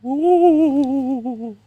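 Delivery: high quivering ghost. Acted. Cohost shows a spooky voice, high, quivering ghost. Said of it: "that quivering sort of vibe".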